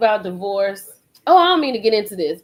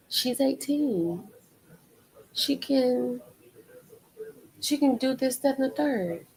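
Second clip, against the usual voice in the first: slow, higher, softer mock of a dismissive father; imitating the father; 4 semitones higher, 6 dB quieter than usual. Mother mocking the dad's dismissive excuse, slow and soft. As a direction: shrug it off like the dad did. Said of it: "and then he says".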